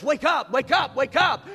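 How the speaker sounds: loud, high wake-up call; God's wake-up call. Pretend. Pastor voicing God waking the church, loud call. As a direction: call them awake like God. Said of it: "But God says".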